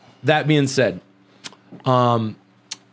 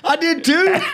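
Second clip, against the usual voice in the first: laughing protest; 5 dB louder than usual. Real, not acted.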